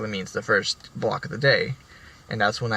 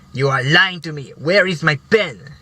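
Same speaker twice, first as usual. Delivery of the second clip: raised, higher and louder insistence; indignant accusation; 5 semitones higher, 6 dB louder than usual. Retold or imitated. Teacher accusing student of stealing pen, raised indignant voice. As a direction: demand it back like she's been robbed.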